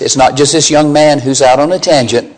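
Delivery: usual speaking voice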